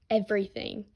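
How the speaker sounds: plain reading voice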